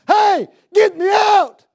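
high, loud, slow cry; preacher imagining himself in hell, screaming out; desperate shout. Pretend. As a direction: yell to be pulled out of hell. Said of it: "if I'm in hell and I get a glimpse of Father Abraham".

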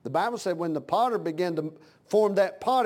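usual speaking voice

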